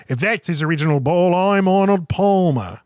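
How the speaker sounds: stagey Irish caddy voice; Irish accent. Acted. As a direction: do an Irish caddy, drawing out the name. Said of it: "perfect Irish accent".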